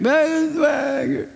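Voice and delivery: high, slow pleading whine; whining woman. Retold or imitated. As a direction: tug at him and beg. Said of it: "she comes up and she pulls his coat".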